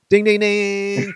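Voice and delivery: high, drawn-out ding-ding-ding; imitated winner's bell. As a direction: ring it out like a quiz-show bell. Signals a correct guess like bell.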